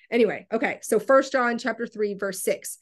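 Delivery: usual speaking voice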